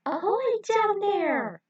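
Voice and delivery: high, slow call from far above. A narrator's reading. Lily calling down from the top step to the pair.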